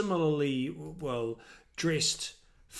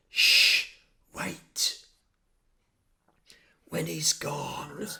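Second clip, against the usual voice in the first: hushed whisper, urgent; slower than usual. Acted. Young girl warns the newcomer, whispering. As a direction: breathe it as a secret warning. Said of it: "and she goes".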